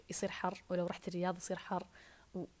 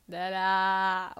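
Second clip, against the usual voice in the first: drawn-out spoiling; 10 dB louder, much slower than usual. Real, not acted. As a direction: stretch دلع very long and louder. Dala3 repeated, second one stretched.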